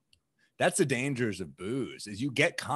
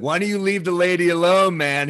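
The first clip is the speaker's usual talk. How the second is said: raised, higher-pitched challenge; retold confrontation; 7 semitones higher, 7 dB louder than usual. Confronting a creepy guy bothering a lady, retold loud challenge. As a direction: call him out, loud and confident.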